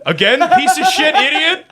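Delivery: high-pitched exasperated telling-off; hammed-up exasperation; mock scolding. Acted scolding by exasperated partner.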